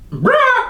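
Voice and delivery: high shrill parrot squawk. Shown as a demonstration. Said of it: "urge to go".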